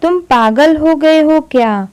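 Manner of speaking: ordinary talk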